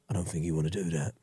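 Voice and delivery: low, quick gangster warning; menacing fixer voice. Retold or imitated. Fixer warning Uncle Phil's guests, low and menacing. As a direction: mutter it like a London heavy, low and fast. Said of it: "he'll come in certain rooms and he go".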